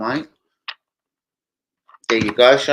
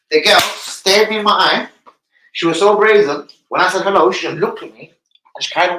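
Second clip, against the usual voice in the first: mock indignant; 6 semitones higher, 6 dB louder than usual. Real, not acted. Indignant retelling.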